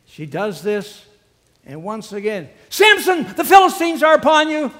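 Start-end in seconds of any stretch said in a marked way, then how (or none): 2.7-4.7 full shout, much higher, fast; shouted alarm. Acted. Delilah shouting the alarm at Samson a third time, full yell. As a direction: yell it to jolt him awake.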